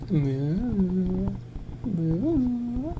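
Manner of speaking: high, drawn-out moos; camp mooing. Acted. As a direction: moo high like a camp cow. Repeating the put-on camp moo.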